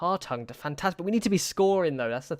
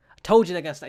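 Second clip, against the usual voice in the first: louder, higher burst of alarm; alarmed outburst; 4 semitones higher, 8 dB louder, much faster than usual. Real, not acted. Gamer watching a goal go in, alarmed outburst. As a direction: blurt it out in panic. Sudden panic at opponent attack.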